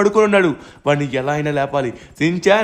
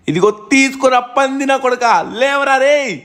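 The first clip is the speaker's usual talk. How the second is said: higher, louder angry yell; shouting; 7 semitones higher, 4 dB louder than usual. Acted. Blasting the titan awake, angry shout. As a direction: roar at him as you fire.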